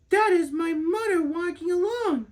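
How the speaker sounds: put-on a little girl sure she's found her mother; little girl's voice. A narrator's reading. Little girl thinks hopefully.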